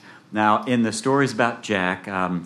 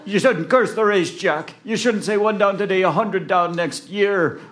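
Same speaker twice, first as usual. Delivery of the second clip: high, loud indignant telling-off; scolding mourner; 10 semitones higher, 4 dB louder than usual. Acted. Mourner telling Jack off, high indignant scolding. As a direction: scold him high and cross.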